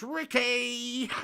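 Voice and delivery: high, drawn-out Ricky cry; Bianca impression. Reading a viewer's message aloud, Bianca impression. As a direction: call it out high, stretching the last syllable. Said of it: "the Bianca impression".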